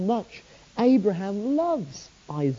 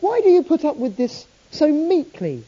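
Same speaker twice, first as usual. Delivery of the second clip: higher, louder indignant question; angry at Abraham; 9 semitones higher, 4 dB louder than usual. Retold or imitated. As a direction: challenge Abraham, exasperated. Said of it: "strangely angry with Abraham".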